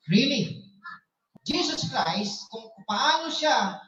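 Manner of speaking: ordinary delivery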